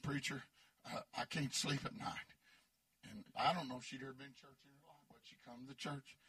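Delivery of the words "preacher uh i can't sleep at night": her low voice. Retold or imitated. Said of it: "she talked like this".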